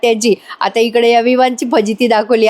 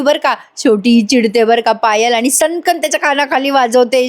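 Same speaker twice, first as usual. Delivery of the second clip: ordinary talk, nothing marked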